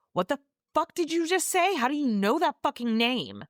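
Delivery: high, indignant mock outburst; angry confrontation. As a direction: turn on him, demanding an answer. Heathcliff turns on him.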